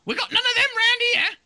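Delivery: a shrill cartoon doe; high-pitched doe voice; squeaky deer voice.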